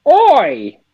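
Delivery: a quick jokey Cockney shout-out; Cockney accent. Retold or imitated. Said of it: "Some Cockney".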